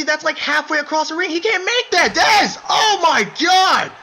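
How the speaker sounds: from disbelief up to a full roar; building excitement to a shout. Acted. Impossible dropkick attempt.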